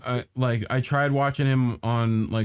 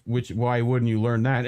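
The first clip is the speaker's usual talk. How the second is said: ordinary talk, nothing marked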